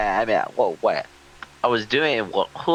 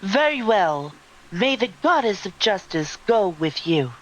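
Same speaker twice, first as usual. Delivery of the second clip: higher, grand solemn character voice; 9 semitones higher than usual. Acted. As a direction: lift it high and make it solemn. Voicing a character in the game.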